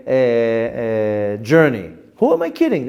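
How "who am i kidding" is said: pitched way up, scolding himself; high exclaimed catchphrase. Shown as a demonstration. Teacher demoing his catchphrase, high and exclaimed. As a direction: fling the question up high in disbelief. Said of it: "That's the term I used to use".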